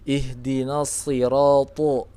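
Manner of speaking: slow "ihdinas sirata" with heavy s and t; thick letters. Shown as a demonstration. Teacher models isti'la, slow "sirata" with heavy consonants. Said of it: "ya, tebal seperti itu".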